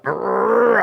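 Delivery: high growly kid noise, imitating; kid's growl. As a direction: mimic a kid growling at a model. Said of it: "he was like".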